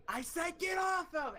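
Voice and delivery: high-pitched mock shout; acted-out yell. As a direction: imitate a friend's desperate command. Friend screaming before striking attacker.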